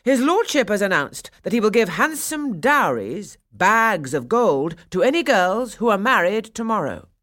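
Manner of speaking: loud, high, swooping proclamation; cried out. A narrator's reading. Burgomaster announcing dowries to the town, crying out. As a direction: proclaim it grandly, stretching the key words. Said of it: "he cried".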